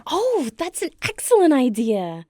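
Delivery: light up at the idea; eager enthusiasm; delighted. Acted. Friend suggests dollhouse plan.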